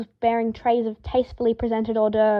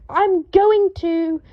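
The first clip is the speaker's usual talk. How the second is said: slow, much higher, louder cut-off threat; enraged threat; 8 semitones higher, 6 dB louder, much slower than usual. A narrator's reading. Draco threatens the ferret before Harry interrupts. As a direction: stretch out a furious threat.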